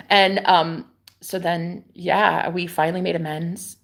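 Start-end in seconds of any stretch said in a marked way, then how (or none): none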